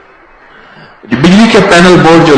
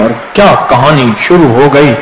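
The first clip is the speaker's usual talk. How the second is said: ordinary talk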